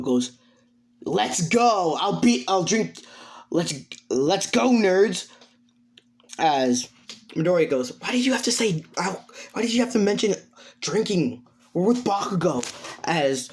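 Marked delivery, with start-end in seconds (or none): 1.1-5.3 high, fired-up rallying; rally the group to go drinking; raised-pitch cocky whooping; rowdy enthusiasm. Acted. Rowdy character keen to drink. 8.0-12.6 high, dismayed complaint; higher-pitched fretful objection; exasperated protest. Acted. Character protests mention of drinking.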